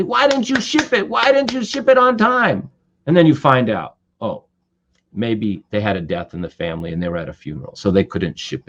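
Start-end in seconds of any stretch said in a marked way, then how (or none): 0.0-2.7 high, accusing demand; high-pitched irritated questioning; angry complaint. Retold or imitated. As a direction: snap it like an impatient boss. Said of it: "you could be angry at somebody".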